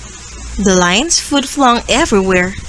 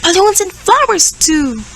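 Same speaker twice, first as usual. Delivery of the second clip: high, happy exclaim; excited cry; 8 semitones higher than usual. Acted. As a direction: cry out with joy. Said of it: "The lion cries".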